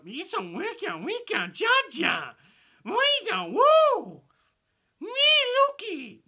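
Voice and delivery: hammed-up Gungan accent building to a shrieking woo; Jar Jar accent. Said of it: "Jar Jar accent for you guys".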